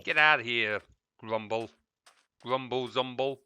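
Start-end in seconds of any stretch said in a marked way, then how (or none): none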